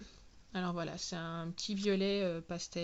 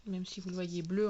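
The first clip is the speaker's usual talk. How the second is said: usual speaking voice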